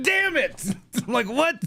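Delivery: high-pitched, laughing mock outrage; exasperated disbelief. Dad retelling his reaction to his wife giving their son gum, high disbelief. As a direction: squeal it in disbelief, then drag it out slowly.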